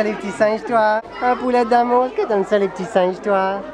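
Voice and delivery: ordinary talk, nothing marked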